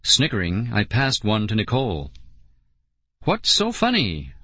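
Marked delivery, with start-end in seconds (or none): 3.2-4.3 high, suspicious question; suspicious girl wanting an answer. A narrator's reading. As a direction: ask it warily, pitched up. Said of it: "Lauren asked suspiciously".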